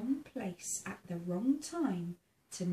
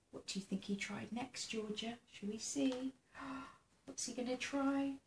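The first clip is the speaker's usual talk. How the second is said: ordinary delivery, nothing marked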